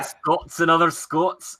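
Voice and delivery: put-on high, slow comic Scottish accent; Willie. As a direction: attempt a cartoon Scotsman, drawn out. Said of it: "That's Willie".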